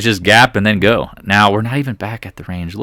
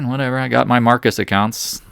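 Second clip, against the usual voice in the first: normal talking voice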